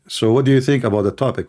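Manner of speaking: brisk, rapid-fire question; quick, pressing delivery; speaking quickly. Real, not acted. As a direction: rattle the question off fast. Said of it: "you're basically speaking quickly".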